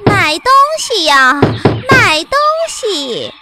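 high, loud peddler's cry; calling out wares. Acted. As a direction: shout wares at the door. Old woman calling at door.

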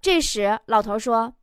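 ordinary talk

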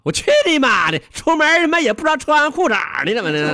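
high-pitched outburst of comic swearing; acted cursing. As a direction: play the farmer cursing up at the crow. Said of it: "老能一抬头就骂了".

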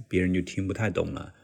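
ordinary talk, nothing marked

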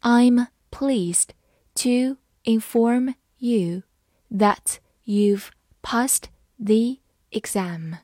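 I'm pleased to inform you read slowly in chunks. Shown as a demonstration. Teacher reading the sentence slowly to the class, phrase by phrase. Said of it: "好，慢慢来读".

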